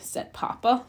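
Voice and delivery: usual speaking voice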